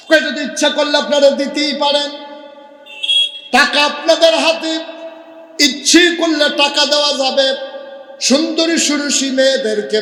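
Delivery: high, loud chanted offer; high preaching cry. Acted. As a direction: sing it out high and forceful, stretching the line ends. (চড়া সুরে জোরালো ওয়াজ)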